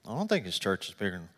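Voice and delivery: low, hushed aside to himself; inner thought. Retold or imitated. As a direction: think it under your breath. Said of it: "in my mind".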